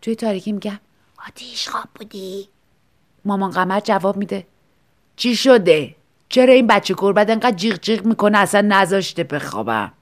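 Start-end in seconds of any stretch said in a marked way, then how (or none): 1.3-2.5 soft unvoiced whisper; whispered question. Asking the neighbour in the dark, whispered. 5.2-9.9 lightly voiced complaining old lady, louder; cranky, woken up; grumpy old woman. Old neighbour complains about the crying kitten, grumpy.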